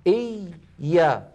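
"إي" held, then a separate "يا"; teacher's split demo of "إي، يا"; split doubled ya. Shown as a demonstration. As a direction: sustain the still ya in "إي", then open to "يا". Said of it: "لاحظوا هكذا".

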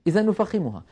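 usual speaking voice

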